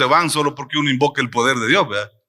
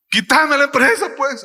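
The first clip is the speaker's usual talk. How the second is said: whiny victim; 9 semitones higher than usual. Retold or imitated. Preacher retelling his prayer, a wounded victim's plea. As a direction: play the victim to God, begging. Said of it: "con ese tono, ¿verdad? O sea, una víctima".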